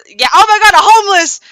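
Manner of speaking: high, loud gasping surprise; high, loud, delighted exclamation; excited shriek. Retold or imitated. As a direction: shriek it like you found a stray puppy. Star finding a stranger.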